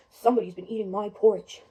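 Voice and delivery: low, loud, big bear voice; Papa Bear. Acted. Father bear finding his porridge eaten, deep and loud. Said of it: "Papa Bear walks over and he says".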